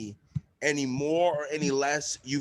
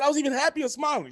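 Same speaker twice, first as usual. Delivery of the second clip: incredulous protest; 13 semitones higher, 4 dB louder, faster than usual. Retold or imitated. Retold protest of innocence.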